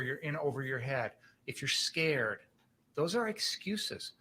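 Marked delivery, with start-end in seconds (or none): 2.9-4.1 those are excuses, slow, high on are; slow emphatic stress. Acted. Hammering point to jury.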